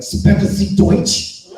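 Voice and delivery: slow, loud hammed-up German; exaggerated fake German accent; mock German. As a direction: bark it like a bad German movie. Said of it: "I gave him the best".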